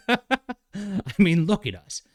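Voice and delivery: folksy Southern twang, laid on thick; overdone Southern folksy accent, mocking; Southern Thing voice. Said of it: "a little too, uh, Southern".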